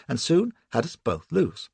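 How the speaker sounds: ordinary delivery, nothing marked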